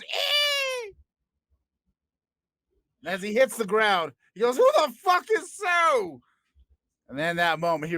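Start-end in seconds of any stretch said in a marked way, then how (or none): 0.0-0.9 very high squeal as he hits the floor; squeaky old-man yelp. Acted. Old man falling to the floor, yelping high. 4.6-6.2 very high, slow bewildered voice; confused old man. Acted. Old man on the floor asking, bewildered and high.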